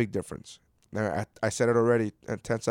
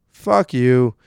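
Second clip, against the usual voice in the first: second "Fuck you." punched out louder; louder repeated curse; 8 dB louder than usual. Real, not acted. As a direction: spit the second one harder.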